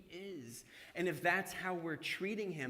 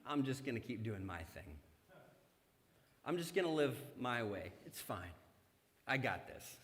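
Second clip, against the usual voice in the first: lower, softer offhand brush-off; dismissive; 5 semitones lower, 4 dB quieter than usual. Retold or imitated. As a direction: shrug Jesus off casually. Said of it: "we are like".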